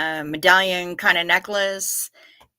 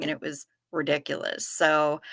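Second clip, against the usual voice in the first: ordinary talk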